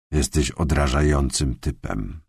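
quiet inner self-reproach; self-scolding inner voice. A narrator's reading. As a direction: scold yourself under your breath. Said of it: "skarcił się w myślach".

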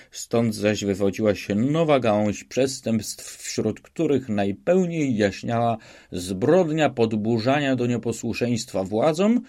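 usual speaking voice

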